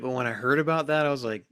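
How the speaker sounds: normal talking voice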